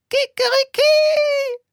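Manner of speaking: very high, loud crowing Kikeriki; rooster crow. Acted. A rooster on the roof crows, high and loud. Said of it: "krähte es vom Dach".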